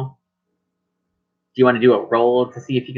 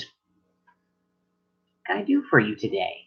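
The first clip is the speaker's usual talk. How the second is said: under gnome greeting; 6 dB quieter, much slower than usual. Acted.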